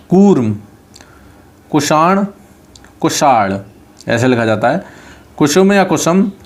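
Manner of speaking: ordinary talk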